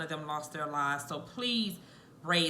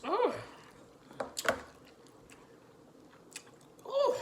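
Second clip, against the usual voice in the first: high pained gasp. Real, not acted. Reacting to the burn.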